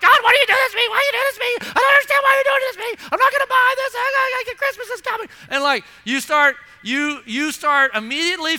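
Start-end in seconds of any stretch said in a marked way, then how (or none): 0.0-5.3 much higher, louder, fast whining; a spoiled child pitching a fit; whiny complaint. Retold or imitated. A believer whining at God, high and loud. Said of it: "You're like".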